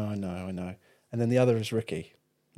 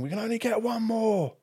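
raised voice, higher pitch; calling out from the boat; 10 semitones higher than usual. Retold or imitated. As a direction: shout it across the water. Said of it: "the guy on the boat's saying".

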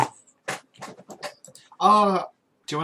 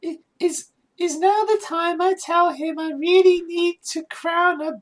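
high, loud, hammed-up German accent; mock German accent; 14 semitones higher, 5 dB louder than usual. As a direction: read the smut in a silly German accent. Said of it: "Do it in a German voice".

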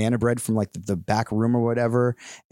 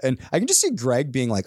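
ordinary talk

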